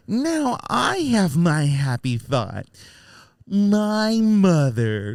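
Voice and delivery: slow, drawn-out, overly earnest line reading; hammed-up wooden child actor; mocking stilted delivery. Mocking the kid's stilted movie line, slow and drawn out. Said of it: "Who talks like that?".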